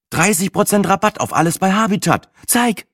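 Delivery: very high-pitched, eager exclamation; excited outburst. Acted. Sina grabbing at news of a discount, excited. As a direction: pounce on the news, voice shooting up.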